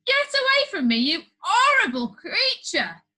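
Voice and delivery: disgusted prime minister. A narrator's reading. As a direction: shoo him away in revulsion. PM recoils from boy.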